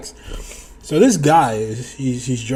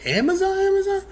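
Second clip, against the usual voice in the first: mock surprise; faster than usual. He pretends to remember.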